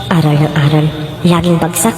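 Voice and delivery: low, private grumbling thought; catty neighbor's inner voice. Acted. Gossiping mother silently judging a student, low inner voice.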